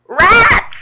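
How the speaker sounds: high, very loud scream. Acted. Frog makes out the creatures on the ledge, screaming. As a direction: shriek it in alarm. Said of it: "and then screams".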